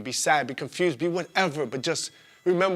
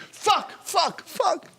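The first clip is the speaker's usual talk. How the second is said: much higher, strained repeated curses; anguished cursing; much slower than usual. Retold or imitated. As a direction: cry out each curse in despair. Cursing in despair.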